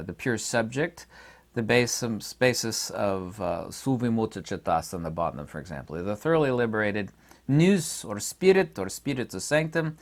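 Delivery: ordinary talk, nothing marked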